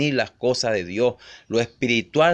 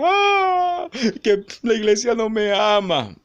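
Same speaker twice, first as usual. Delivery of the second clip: hammed-up wail, much higher, in a mocking falsetto; mock crying; 10 semitones higher than usual. Preacher mocking a weeping God in a high fake wail. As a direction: sob it out like a mocking caricature. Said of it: "Dios empezó a llorar".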